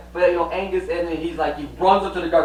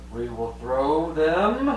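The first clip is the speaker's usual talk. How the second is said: delighted king; much slower than usual. Retold or imitated. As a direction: announce the feast with glee. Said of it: "The king goes".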